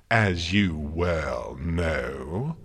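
very slow, drawn-out malice; growing malicious. A narrator's reading. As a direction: stretch every word out with menace. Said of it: "his tone growing malicious".

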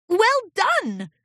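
bright pleased teacher voice; warm praise. A narrator's reading. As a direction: praise the colt warmly. Celestia praises class.

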